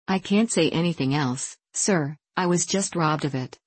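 ordinary delivery

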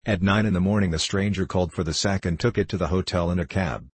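ordinary delivery

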